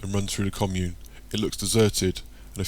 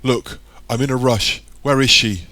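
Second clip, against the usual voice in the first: loud, raised, impatient demand; hasty; 4 semitones higher, 7 dB louder than usual. A narrator's reading. Michael in a rush to find Tara, hasty and loud. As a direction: press urgently for an answer. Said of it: "says Michael hastily".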